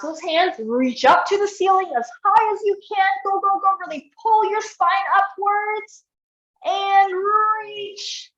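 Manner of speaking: high, pushing encouragement; urging coach. Real, not acted. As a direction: spur them on to reach further.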